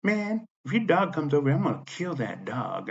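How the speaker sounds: high-pitched mock of a threatening woman; imitated angry woman. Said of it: "And she goes".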